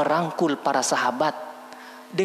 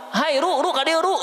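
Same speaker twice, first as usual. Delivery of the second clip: cheeky student calling; 9 semitones higher than usual. Retold or imitated. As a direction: call the teacher like a buddy. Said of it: "muridnya manggil guru".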